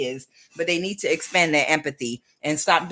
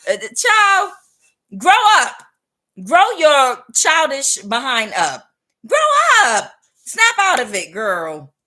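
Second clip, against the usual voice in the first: high, loud, slow scolding; 7 semitones higher, 9 dB louder, slower than usual. Real, not acted. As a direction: yell at her to grow up. Scolding childish woman loudly.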